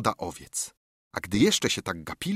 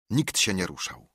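usual speaking voice

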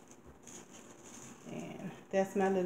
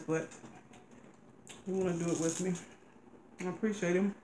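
speaking between bites; talking while chewing. Real, not acted. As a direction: mouth partly full of food. Seen 1: wife eats mushrooms, lips pressed chewing between phrases throughout.

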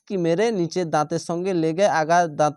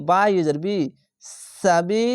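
ordinary talk

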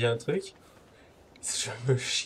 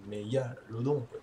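slow, drawn-out mégalodon quoi; slow dramatic voice; 4 dB quieter, slower than usual. Shown as a demonstration. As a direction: say mégalodon quoi slowly and ominously. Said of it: "c'est mégalodon quoi".

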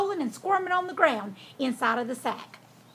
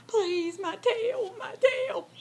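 moaning fox; 8 semitones higher, much slower than usual. A narrator's reading. Fox pleads with pig over his trapped tail, moaning. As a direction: moan pitifully over a hurt tail. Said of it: "lowered his voice to a moan".

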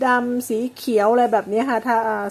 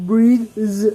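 slow model word; 5 dB louder than usual. Shown as a demonstration. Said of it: "teacher models the word slowly".

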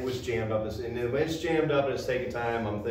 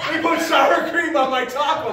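high, loud laughing protest; mock outraged complaint; 11 dB louder than usual. Wife complaining about sour cream, loud and high. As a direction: whine it like a tragedy. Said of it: "She goes".

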